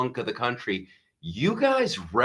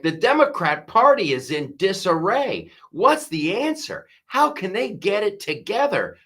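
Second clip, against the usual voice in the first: higher, fretting pundit voice; mocking Fox pundits; 9 semitones higher than usual. Comedian mocking Fox News hosts worrying about Democrats, high fretful voice. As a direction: ham up worried concern. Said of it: "They're like".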